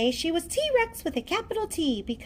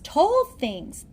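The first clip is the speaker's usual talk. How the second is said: ordinary delivery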